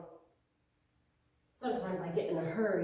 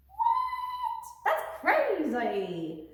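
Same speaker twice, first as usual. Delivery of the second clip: high squeaky surprise; much slower than usual. Acted. Mock astonishment at fact.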